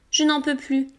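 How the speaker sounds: ordinary delivery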